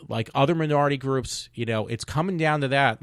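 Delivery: ordinary talk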